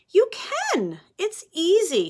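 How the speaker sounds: put-on high, bright and loud older-sister reassurance; big sister voice. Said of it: "says his sister".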